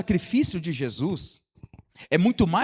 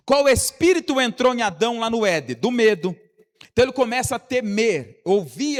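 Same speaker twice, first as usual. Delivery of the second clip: loud, higher-pitched sermon delivery; insistent, raised preacher's voice; full-force preaching; 7 semitones higher, 7 dB louder than usual. Real, not acted. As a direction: drive each point home to the congregation.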